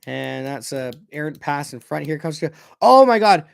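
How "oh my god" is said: loud, excited exclamation; real burst of disbelief mid-commentary; shocked outburst. Real, not acted. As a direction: cry out at the sudden chance. Shock at play in front.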